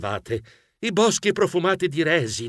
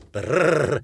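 shivering brrr; 2 semitones lower than usual. Acted. Blast of icy wind at door.

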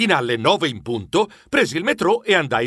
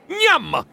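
gulp noise; 11 semitones higher than usual. Acted. Trappola gulps the cheese.

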